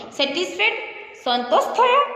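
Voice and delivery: high rising question on "સંતોષ થયો"; "સંતોષ થયો" asked with pitch jumping up. A narrator's reading. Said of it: "તેને આમ ક્વેશ્ચનથી પૂછ્યું".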